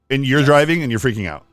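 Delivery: usual speaking voice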